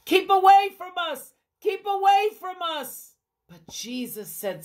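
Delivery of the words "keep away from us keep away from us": high, loud, fast warning yell; scared crowd shouting at lepers; frightened shout. Acted. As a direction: scream at them to stay back. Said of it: "They began to shout".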